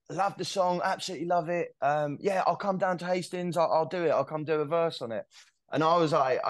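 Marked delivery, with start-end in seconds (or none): 0.1-5.2 higher, easygoing London bloke voice. Retold or imitated. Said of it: "And he's just like".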